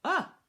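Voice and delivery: loud, high startled "Ah!"; short yelp of fright; startled gasp. Acted. Boy on seeing August, startled cry. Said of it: "but I kinda went".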